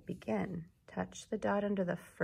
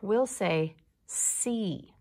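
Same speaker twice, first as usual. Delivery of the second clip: slow modeled word; 4 dB louder, much slower than usual. Shown as a demonstration. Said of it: "When we see this picture word".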